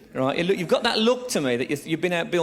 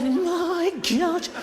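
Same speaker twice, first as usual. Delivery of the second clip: high, put-on sitcom exclamation; mock sitcom gasp "My God"; Leonard Rossiter impression; 13 semitones higher than usual. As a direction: play the mum as a sitcom character. Mum voiced as Leonard Rossiter.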